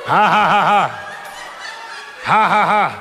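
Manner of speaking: holy laughter. Acted. Preacher laughing at the devil, rhythmic ha-ha. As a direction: laugh out the joy deliberately.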